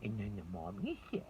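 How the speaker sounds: storybook narrator voice. Shown as a demonstration. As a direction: read it like a bedtime story to a child. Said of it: "take on the voice of that character".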